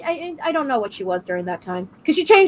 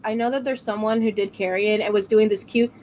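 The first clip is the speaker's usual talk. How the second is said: ordinary talk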